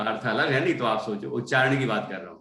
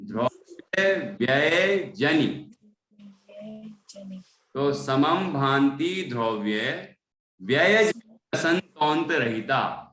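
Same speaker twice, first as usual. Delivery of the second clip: slow recitation demo; slower than usual. Shown as a demonstration. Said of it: "व्यय से शुरू करिए".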